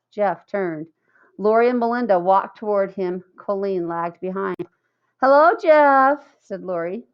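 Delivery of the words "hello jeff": drawn-out, louder singsong hello; teasing hello. A narrator's reading. Lori greeting Jeff to tease him, slow loud singsong.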